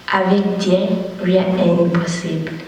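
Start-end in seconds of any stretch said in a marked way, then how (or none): none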